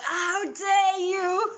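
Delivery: slow, very high, loud indignant shout; drunk outrage. Acted. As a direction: bellow it as a drunk husband offended. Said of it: "and he's like".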